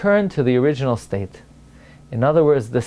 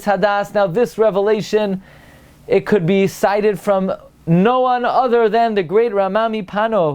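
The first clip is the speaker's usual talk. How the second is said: raised pitch, emphatic sermon build-up; keyed-up preacher introducing a great sage; rousing preaching; 6 semitones higher than usual. Acted. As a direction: announce a great revelation to the congregation. Announces a revelation.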